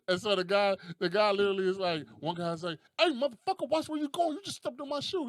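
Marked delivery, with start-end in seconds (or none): 3.0-5.3 high-pitched comic outrage; mock angry confrontation. Guy whose shoe got stepped on, mock furious. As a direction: pick a fight in a raised, high voice. Acted quarrel between two guys.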